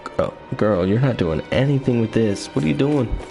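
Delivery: normal talking voice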